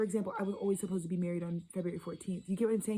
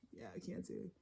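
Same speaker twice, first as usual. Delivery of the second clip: ordinary talk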